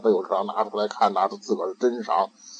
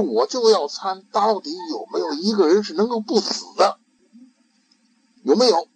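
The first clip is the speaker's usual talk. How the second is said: put-on high, insistent child's question; eager child; 10 semitones higher, 4 dB louder than usual. Young Ikkyu asking his master, higher child voice. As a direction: press the question like a bold little novice.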